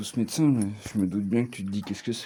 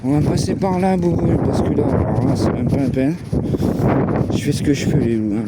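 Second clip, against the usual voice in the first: loud; 4 semitones higher, 7 dB louder than usual. Real, not acted. Talking loudly to the dog in wind.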